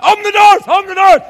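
loud, high mock panic holler; panicked shout. Man running through house with live rat, panicked shout. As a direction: holler urgently as if running with something horrible.

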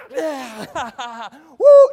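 loud high whoop; cheering crowd. Retold or imitated. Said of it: "Cheering, all fired up".

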